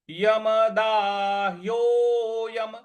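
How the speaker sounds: यमदाह्योयम said slowly with the ह्यो drawn long; slow teaching demo of यमदाह्योयम with a long ओ. Shown as a demonstration. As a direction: stretch the ओ in यमदाह्योयम, slow and careful. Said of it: "ओ की मात्रा है उसको दीर्घ खींचना है".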